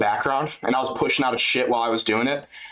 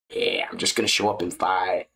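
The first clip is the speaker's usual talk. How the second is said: lower, lazy fighter voice; JDM impression; 7 semitones lower than usual. Doing a JDM impression for chat, lower voice. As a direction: shrug it off like JDM. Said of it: "J D M impression".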